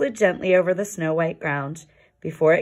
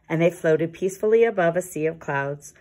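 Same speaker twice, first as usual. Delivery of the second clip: ordinary delivery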